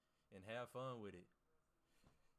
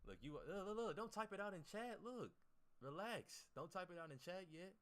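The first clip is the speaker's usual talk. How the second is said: higher and louder, rushing to stop them; urgent hushing call; 9 semitones higher, 3 dB louder than usual. Real, not acted. Streamer urgently telling chat not to type the secret. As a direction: cut chat off before they spoil it.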